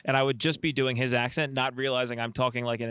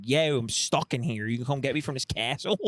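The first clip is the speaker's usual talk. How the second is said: put-on Delco damsel accent. Delco damsel calling for rescue from castle, thick Delco accent. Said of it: "like a beautiful damsel in distress who's from Delco".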